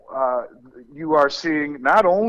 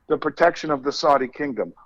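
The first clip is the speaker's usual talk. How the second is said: usual speaking voice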